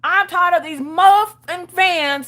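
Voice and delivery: high, loud, exasperated complaining; a kid sick of creeps; mock furious little girl; fed-up Maggie. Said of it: "Maggie's over here like".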